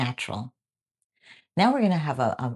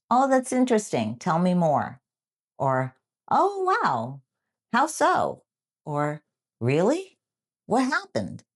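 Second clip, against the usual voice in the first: interested reaction; 5 semitones higher than usual. Shown as a demonstration. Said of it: "sound natural and don't try too hard".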